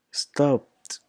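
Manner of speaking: "stopped" as one syllable, stopt. Shown as a demonstration. Teacher demonstrating the T sound, "stopped" as stopt. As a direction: finish "stopped" on a released T. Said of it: "There is a T sound".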